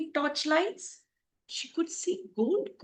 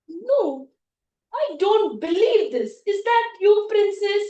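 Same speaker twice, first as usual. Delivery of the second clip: raised, emphatic astonishment; loud disbelief; 6 semitones higher, 9 dB louder than usual. Acted. Prince seeing the princess arrive, incredulous. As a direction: exclaim as if unable to believe it.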